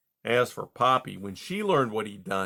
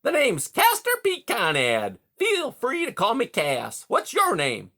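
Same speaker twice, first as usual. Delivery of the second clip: much higher, louder hearty voice; hearty beaver; 11 semitones higher, 5 dB louder than usual. A narrator's reading. Beaver Mister Conrad greeting caged Rye, high and hearty. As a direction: boom out a jovial salesman beaver. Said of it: "with his usual heartiness".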